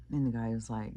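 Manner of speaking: ordinary delivery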